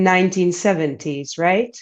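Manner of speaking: ordinary talk, nothing marked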